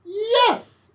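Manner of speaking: high loud witch cry; high excited witch shout; witch's cry. Acted. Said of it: "cried the witch".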